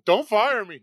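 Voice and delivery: loud, high joking plea not to be fired; mock plea. Guest begging the host not to fire him, loud mock panic.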